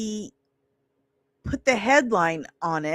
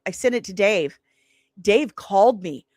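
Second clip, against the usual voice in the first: normal talking voice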